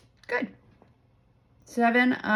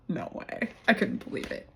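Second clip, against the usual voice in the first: hushed whisper of disbelief; retold awed whisper; 6 dB quieter than usual. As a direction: whisper it as if still stunned. Retold stunned reaction.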